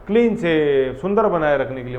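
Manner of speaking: usual speaking voice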